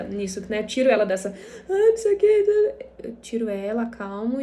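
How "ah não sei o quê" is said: high, loud mock whine; whining child. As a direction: imitate a toddler's whiny fussing. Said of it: "a Esther começa a choramingar". (choramingo de criança imitado, agudo e alto)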